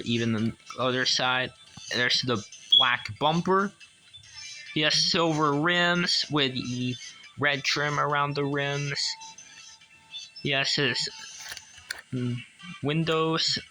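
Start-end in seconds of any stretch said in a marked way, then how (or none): none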